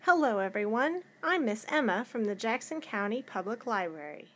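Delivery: ordinary delivery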